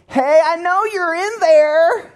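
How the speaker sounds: very high, drawn-out pestering call; Pat. Acted. Neighbor calling through the intercom, high comic voice. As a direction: sing it out slowly in a high comic voice. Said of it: "he talked like this".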